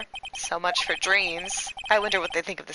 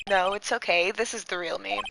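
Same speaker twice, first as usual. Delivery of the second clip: normal talking voice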